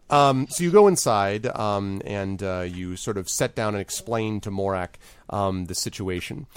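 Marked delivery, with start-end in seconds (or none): none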